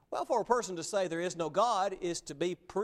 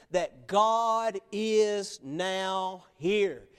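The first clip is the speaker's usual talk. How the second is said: very slow, louder, "now here" drawn out; emphatic conclusion; 5 dB louder, much slower than usual. Real, not acted. Preacher driving home his conclusion to the congregation, slow emphatic. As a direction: land the point slowly, weighting "now here".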